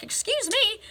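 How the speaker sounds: high-pitched mock-indignant "excuse me"; indignant retort. Retelling her indignant email reply.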